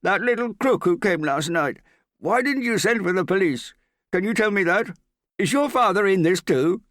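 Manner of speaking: high, loud, furious interrogation; shouting. A narrator's reading. Morgan rages at Hester about last night's crook, shouting. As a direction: fire accusing questions at full shout. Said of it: "suddenly beginning to shout".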